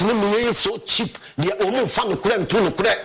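ordinary talk

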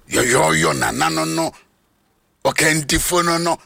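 ordinary talk, nothing marked

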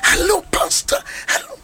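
high, breathless "Hello, pastor" like an eager fan; excited greeting. Retold or imitated. A lady spotting her pastor, excited high greeting. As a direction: squeal it like a thrilled admirer. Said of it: "Ɔyɛ excited".

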